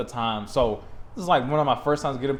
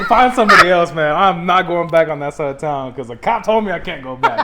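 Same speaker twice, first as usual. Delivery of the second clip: emphatic refusal; 2 semitones higher, 6 dB louder than usual. Retold or imitated. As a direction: refuse point-blank, loudly. Refusing the friend's request.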